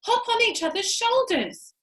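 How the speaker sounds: high, loud hurried urging; urgent urging. A narrator's reading. Monkey father urging his family to hurry, high and loud. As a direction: hurry everyone along, high and pressing.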